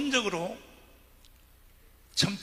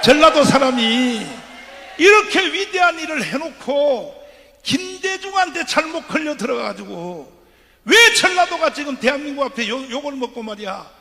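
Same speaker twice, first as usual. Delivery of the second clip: preaching at full force; 7 semitones higher, 14 dB louder than usual. Acted. Preacher rousing the rally crowd to rise up, full-force shout.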